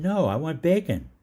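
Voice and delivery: loud, high retold protest; exasperated. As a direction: insist loudly, acting out exasperation. Exasperated food order retold.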